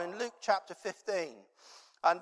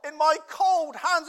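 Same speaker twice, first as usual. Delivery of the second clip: put-on high, loud, slow, whiny boy close to tears; child's complaint, much higher and louder; upset child; 11 semitones higher, 11 dB louder, slower than usual. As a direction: plead like a frozen, frustrated kid. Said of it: "it's just like, basically".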